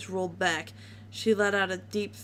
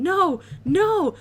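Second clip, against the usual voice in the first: much higher, louder, desperate shriek; screaming no; 11 semitones higher, 10 dB louder than usual. A narrator's reading. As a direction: scream it in panic. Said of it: "Dash screamed".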